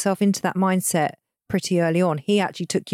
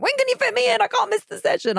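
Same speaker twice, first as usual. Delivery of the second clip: squeaky, urgent panicked plea; high-pitched panic; faster than usual. Retold or imitated. Retold urgent texts.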